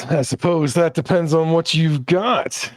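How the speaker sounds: high, loud little creature; little one's voice. Acted. Little shopkeeper answering customer, high and loud. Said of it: "the little one says".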